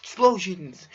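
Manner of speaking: loud, high burst of excitement; excited shout. Real, not acted. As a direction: shout it like a big reveal. Excited shout at game reveal.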